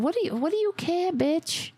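high, rushed challenge; indignant retort. Acted. Challenging the woman in the clip, indignant. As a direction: cut in sharply, pitch jumping up.

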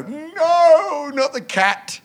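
high mock panto cry; panto jester. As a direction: play it big for the kids. Panto jester line performed.

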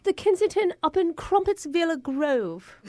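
hammed-up quaint British villager; mock British, proudly quaint; fake British accent. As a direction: put on a storybook British accent.

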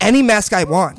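mildly defiant Karen voice. Acted. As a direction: push back like a Karen. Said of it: "we could be Karens".

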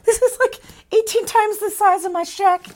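very high, overwhelmed squeal; hammed-up amazed squeak; mock awe. Overwhelmed girl in new room.

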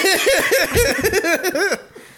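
a burst of high, loud laughter; loud cackle breaking the story. Real, not acted. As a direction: crack up loudly at yourself. Caller laughing mid-story.

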